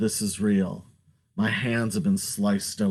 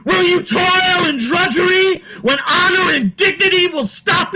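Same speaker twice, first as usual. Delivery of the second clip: high, loud preaching shout; hollering; 9 dB louder than usual. Acted. Man running away hollering at the people, fierce shout. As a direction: holler it at the crowd like a prophet. Prophetic shouting sermon continues.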